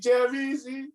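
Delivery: high-pitched call across the room; retold excited yell. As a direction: yell the name as if from your seat. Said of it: "I'm just fucking yelling from my seat".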